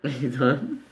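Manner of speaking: normal talking voice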